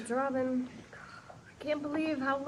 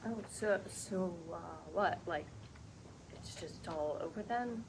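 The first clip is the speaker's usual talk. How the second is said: much softer, stunned and hurt; hurt and quiet; 7 semitones lower, 12 dB quieter than usual. Acted. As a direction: speak low, as if the floor dropped out. Lily shaken by the news.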